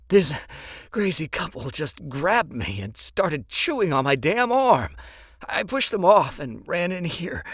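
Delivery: high, strained panicked victim; wounded man. Acted. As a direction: tell it in pain and panic. Wounded man tells attack.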